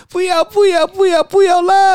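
put-on very high, loud, slow squealing toddler refusal; toddler tantrum. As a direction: squeal it like a kid throwing a fit. (高声尖叫模仿小孩耍赖)

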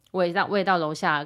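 normal talking voice

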